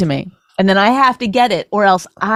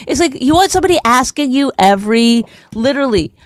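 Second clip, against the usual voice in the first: normal talking voice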